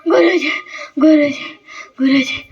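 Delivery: panting calls after running; acted breathless schoolchild; out of breath. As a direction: call the teacher in short breathless bursts. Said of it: "काही मुलं पळत येतात".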